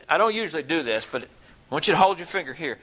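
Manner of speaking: normal talking voice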